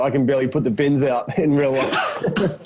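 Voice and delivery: self-mocking, laughter bubbling through; laughing. Real, not acted. Actor admitting he could not fight crime, laughing. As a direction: break into laughter on the end.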